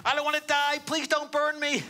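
sniveling wail. Retold or imitated. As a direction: squeal like a child begging for his life. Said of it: "begins to cry and wail and snivel and shouts out".

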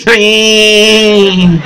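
usual speaking voice

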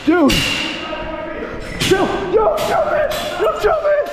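very high, piercing desperate yell; panicked shouting. Real, not acted. Pleading with a machete attacker, panicked screaming. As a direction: scream at him to stop.

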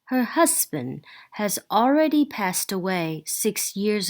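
slow, measured English reading; passage read-aloud. Real, not acted.

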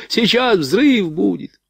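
high, drawn-out warning cry; alarmed shout. A narrator's reading. Rifleman warns of the coming blast, shouting in alarm. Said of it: "вдруг закричал подбежавший стрелок".

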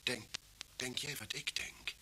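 slow hushed whisper; conspiratorial whisper. Acted. Detective whispers his suspicion to the inspector, hushed and slow. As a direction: lean in and breathe the question. Detectives realise poisoning link.

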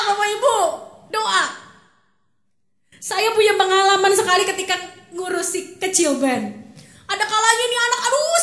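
ordinary talk, nothing marked

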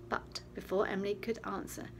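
usual speaking voice

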